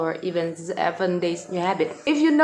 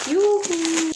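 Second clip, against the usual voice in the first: excited squeal; 10 semitones higher than usual. Real, not acted. Package opened.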